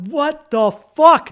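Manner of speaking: loud, slow, stretched-out outburst of disbelief; shocked shout. Retold or imitated. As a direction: yell it, dragging every word in shock. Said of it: "and I'm like".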